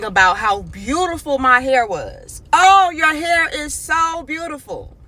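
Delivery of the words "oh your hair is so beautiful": high, gushing "Oh"; gushing praise. Retold or imitated. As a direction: rave about her hair, a bit hammed-up. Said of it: "ranting and raving".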